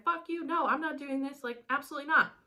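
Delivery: high-pitched, emphatic mock outrage; freaking out. Captive woman refusing to handcuff herself, freaking out. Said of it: "she starts freaking out".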